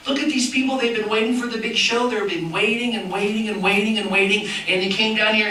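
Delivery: ordinary talk, nothing marked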